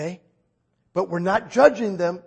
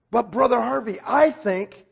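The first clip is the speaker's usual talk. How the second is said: higher, louder imagined objector; voiced objection; 5 semitones higher, 4 dB louder than usual. Congregant starting to argue with Brother Harvey, voiced objection. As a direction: cut in with a mock protest.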